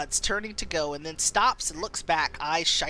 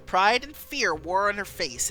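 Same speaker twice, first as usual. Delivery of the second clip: ordinary talk, nothing marked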